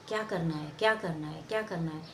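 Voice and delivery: low, soft self-questioning; musing to oneself. Acted. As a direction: ponder it quietly, low in pitch. Actor musing over a script.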